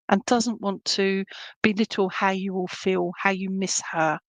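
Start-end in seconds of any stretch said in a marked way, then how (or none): none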